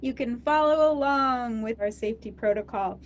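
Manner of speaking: cheery in-flight safety announcement; flight attendant. Acted.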